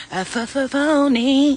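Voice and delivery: "phony" stretched out after a stuttering build-up; stuttered, drawn-out PHONY. Real, not acted. Calling out a fake friend, stuttered and drawn-out "phony".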